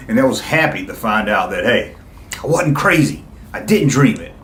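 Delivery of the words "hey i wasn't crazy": ordinary talk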